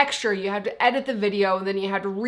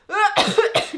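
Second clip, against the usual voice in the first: choking cough; 11 semitones higher, 3 dB louder than usual. Real, not acted. Choked on own spit.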